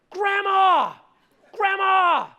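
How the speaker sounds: high, loud call across the pool; imitating a kid yelling for Grandma; girl's giant-voiced call. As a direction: holler it high and drawn out. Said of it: "in a giant voice".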